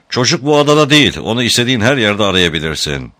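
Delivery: high, loud, quick start, easing off; defiant retort. A narrator's reading. El Avuf denies that the boy is on the island, defiant retort. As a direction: push back forcefully, then dismiss him. Captor taunts Tom in a quarrel.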